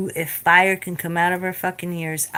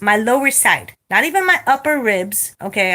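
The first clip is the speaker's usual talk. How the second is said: ordinary delivery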